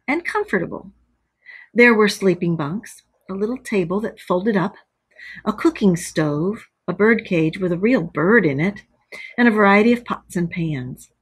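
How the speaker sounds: ordinary delivery, nothing marked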